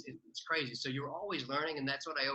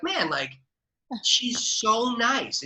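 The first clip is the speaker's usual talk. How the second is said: touched gush; 10 semitones higher, 14 dB louder, much slower than usual. Retold or imitated. Touched reaction to friend's text.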